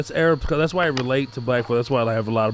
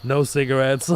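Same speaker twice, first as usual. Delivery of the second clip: normal talking voice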